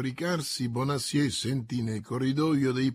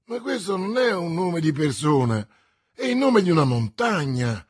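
loud, raised exasperated protest; exasperated exclamation; 4 semitones higher, 8 dB louder than usual. A narrator's reading. Investigator baffled by the musketeer's name, exasperated. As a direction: exclaim as if losing your head. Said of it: "esclamò il povero inquirente".

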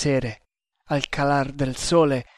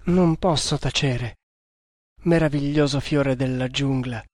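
ordinary delivery, nothing marked

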